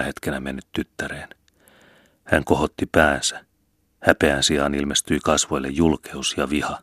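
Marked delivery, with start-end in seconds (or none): none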